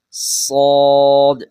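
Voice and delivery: سواد slow, thick and loud; heavy swaad. Shown as a demonstration. Teacher drawing out heavy swaad for pupils. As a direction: make سواد full and heavy. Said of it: "سواد تھوڑا سا پر کر کے پڑھا جاتا ہے".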